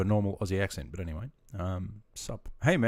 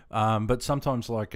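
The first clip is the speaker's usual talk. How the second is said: usual speaking voice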